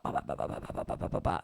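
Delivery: quiet machine-gun run of bas; rapid ba-ba imitation. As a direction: rattle off bas like something cycling. Imitates fast cycling through words.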